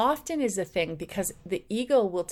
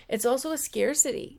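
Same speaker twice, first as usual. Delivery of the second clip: usual speaking voice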